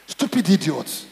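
ordinary talk, nothing marked

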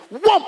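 high-pitched "whoop" of instant arrival; quick high vocal whoosh; sudden whoosh noise. Acted. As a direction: whoop up high like being zapped there. Imitates the boat's instant arrival.

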